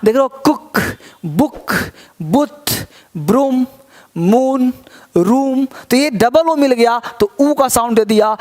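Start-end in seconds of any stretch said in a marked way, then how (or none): none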